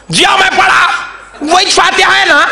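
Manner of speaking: high, shouted reply with a laugh; defiant shout. Retold or imitated. Cleric answers the questioner with a high laughing shout. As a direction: blurt it out high, pleased with himself.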